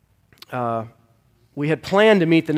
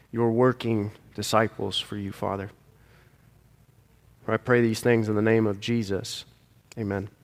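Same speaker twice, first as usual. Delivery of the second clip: prayer; 3 semitones lower than usual. Acted. Pastor leads closing prayer.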